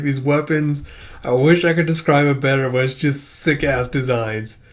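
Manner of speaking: plain reading voice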